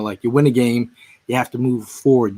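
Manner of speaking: ordinary talk, nothing marked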